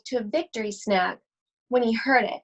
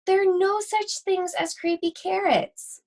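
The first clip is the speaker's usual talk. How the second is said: dismissive mom; 8 semitones higher than usual. Acted. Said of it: "shaking her head".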